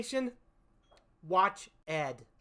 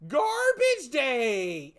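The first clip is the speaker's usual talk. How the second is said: very slow, higher, loud mock villain cry; hammed-up killer; 4 semitones higher, 6 dB louder, much slower than usual. Killer shouting at the man taking out garbage, hammed-up slow yell. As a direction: stretch it out like overacted camp. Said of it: "bug eyes, and horrible acting".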